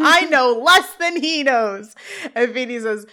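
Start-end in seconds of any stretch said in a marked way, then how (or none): 0.0-1.9 higher, louder, drawn-out mock yell; retold yell. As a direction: yell it slowly as an interjection. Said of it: "And Sean yells".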